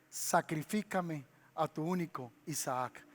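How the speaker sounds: a hint of a low solemn divine voice; voice of God. God commands Abraham to sacrifice his son, low and grave. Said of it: "escuchó la voz de Dios que le dijo".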